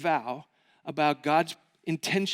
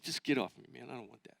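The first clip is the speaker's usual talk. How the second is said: dejected brush-off; 8 semitones lower, 13 dB quieter, faster than usual. Retold or imitated. Dejected friend pushes buddy away.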